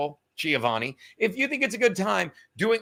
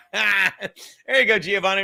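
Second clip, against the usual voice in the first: laughing; 3 dB louder than usual. Real, not acted. Laughing at a viewer comment.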